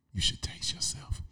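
breathy whisper, voice dropped away; leaning in to whisper. Shown as a demonstration. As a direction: lean in close and whisper it. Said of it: "do you whisper that like that".

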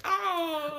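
high laughing mock scream of a falling man; imitated Wilhelm scream. Imitating the movie scream for a friend: laughing high yelp. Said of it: "they put in a Wilhelm scream where it's like".

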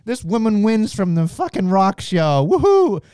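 mock manager hype with a big yelled woohoo; hyped-up Malcolm Bivens. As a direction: ham up the manager's slow brag, then whoop it. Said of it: "he's like".

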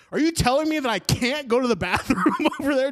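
high, fast mock outrage; indignant meltdown retold for laughs; freaking out. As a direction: rant in disbelief, half laughing. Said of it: "freaking out at this woman".

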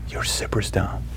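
soft whispered repeat. Retold or imitated. Girl repeating her private warning to the speaker, whispering. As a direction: whisper it discreetly into his ear. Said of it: "she whispers in my ear".

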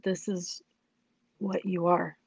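retold softly, with a grave pause; God's inner voice. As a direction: let it land quietly, as heard within. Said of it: "everything tuned out but his voice".

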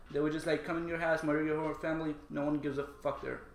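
ordinary delivery

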